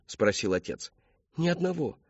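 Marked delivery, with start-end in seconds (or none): 1.3-2.0 put-on high young voice; daughter's protest. A narrator's reading. Daughter denying lovers to father, high protesting voice. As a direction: protest in a pitched-up voice.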